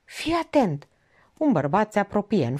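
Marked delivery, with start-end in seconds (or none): 0.0-0.9 a strained, choked warning, pitched higher. A narrator's reading. Doctor warning the vet of a running man, choked voice. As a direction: squeeze the words out through a tight throat. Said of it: "cu voce gătuită".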